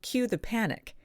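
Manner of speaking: normal talking voice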